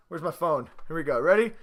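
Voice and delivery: ordinary delivery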